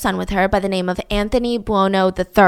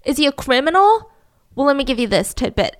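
ordinary talk, nothing marked

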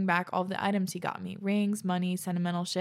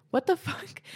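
laughing disbelief; 9 semitones higher than usual. Real, not acted. Reacting to gun threat.

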